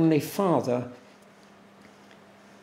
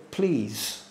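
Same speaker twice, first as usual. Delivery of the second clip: a softer, lower, gently asking please; polite please; 4 semitones lower, 5 dB quieter than usual. Shown as a demonstration. Preacher to congregation, quoting the please God never says, soft and low. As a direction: set the word "Please." apart as a soft, lower polite request. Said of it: "nowhere does God say".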